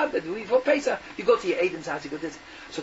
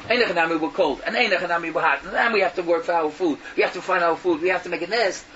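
normal talking voice

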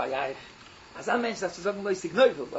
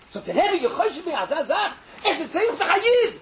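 excited laughing retelling; 10 semitones higher, 7 dB louder than usual. Retold or imitated.

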